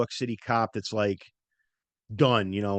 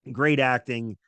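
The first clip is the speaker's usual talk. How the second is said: normal talking voice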